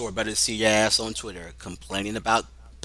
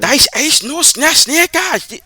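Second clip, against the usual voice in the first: high loud fake German gibberish; mock German nonsense, shouted high; 10 dB louder, slower than usual. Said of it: "What the fuck did you just say?".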